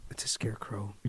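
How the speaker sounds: hushed whisper. Real, not acted. Spotting the scarecrow outside, whispering to himself. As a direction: breathe it out under your breath.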